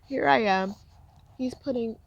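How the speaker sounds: normal talking voice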